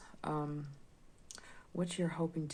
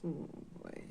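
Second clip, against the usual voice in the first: whispering; 6 dB quieter than usual. Real, not acted. Reacting to the card.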